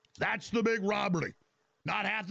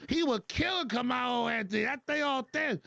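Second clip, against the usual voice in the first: high, hammed-up caricature; mock exaggerated testimony; 6 semitones higher, 12 dB louder than usual. Host parodying a politician hyping a killer, hammed-up. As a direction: push the pitch up and overplay every claim. Mocking retelling of a fight claim.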